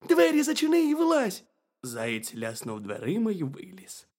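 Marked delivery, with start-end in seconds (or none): 0.1-1.4 very high, loud squeaky shout; piercing little critter call; shouting hedgehog. A narrator's reading. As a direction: yell it as a tiny hedgehog.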